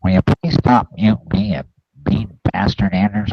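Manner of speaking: ordinary talk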